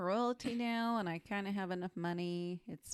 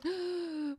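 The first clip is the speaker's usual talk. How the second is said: mock gasp; 11 semitones higher than usual. Real, not acted. Listener gasps at reveal.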